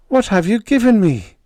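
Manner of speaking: overwhelmed with joy, ask it to the sky; high astonished rapture; awed joyful cry. Acted. Trembling joy at gift.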